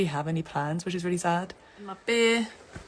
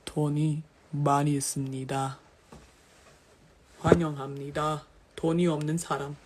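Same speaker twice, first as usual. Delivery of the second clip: low game-host delivery; put-on lower voice; 4 semitones lower, much faster than usual. Shown as a demonstration. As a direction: keep it down in a low register. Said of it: "you want me to do the lower?".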